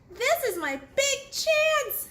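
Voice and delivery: very high thrilled squeal; high, excited little bee voice; excited shout. Acted. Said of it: "she shouted".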